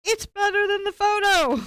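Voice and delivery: very high, loud exclamation imitating a convert; mock amazed onlooker. Painting teacher imitates the onlooker coming back, mock amazement. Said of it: "they come back and they're like".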